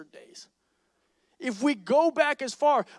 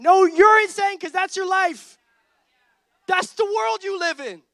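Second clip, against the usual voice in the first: emphatic comeback; 8 semitones higher, 10 dB louder than usual. Acted. Preacher turns "you're insane" back on the congregation, loud. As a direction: fire the accusation back at the room. Turning the accusation on the crowd.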